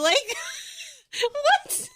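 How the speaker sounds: high laughing disbelief; high laughing what. Real, not acted. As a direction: laugh it out, incredulous. Laughing at the memory.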